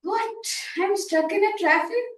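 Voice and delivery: high, startled What; surprised. Pretend. Member acting shock at being stuck in traffic, high. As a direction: gasp it out in disbelief. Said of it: "in the surprising tone".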